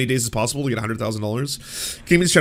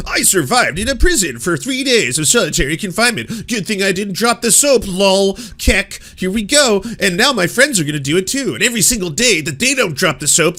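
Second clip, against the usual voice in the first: loud, high hyped-up YouTuber voice; a mocking MrBeast-style video pitch; mock YouTuber hype; 5 semitones higher, 6 dB louder than usual. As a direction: ham up a clickbait host.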